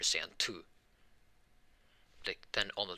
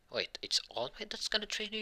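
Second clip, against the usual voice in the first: higher-pitched, mildly astonished question; surprised question; 13 semitones higher than usual. Retold or imitated. Friend learns All Might will train Deku, surprised.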